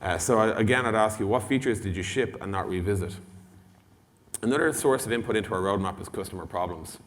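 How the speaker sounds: ordinary talk, nothing marked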